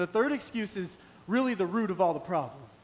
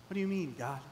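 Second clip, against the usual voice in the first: ordinary delivery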